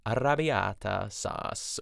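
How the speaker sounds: Arrabbiata drawn out slowly, every syllable clear; slow, deliberate Arrabbiata; slow careful pronunciation. Shown as a demonstration. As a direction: take your time over Arrabbiata. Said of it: "I wanna, like, make sure I say this right".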